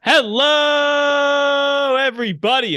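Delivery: high, loud greeting shout; loud show intro. Real, not acted. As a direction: belt out the welcome to the show. Podcast host opening the show.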